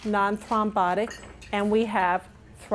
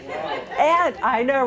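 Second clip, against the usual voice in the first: laughing; 2 semitones higher, much slower than usual. Real, not acted. Still laughing at own joke.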